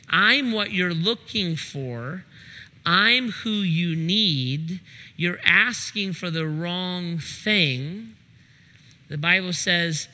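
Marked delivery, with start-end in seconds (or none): 0.1-8.2 slow and deliberate. Acted. Preacher voicing Jesus slowly.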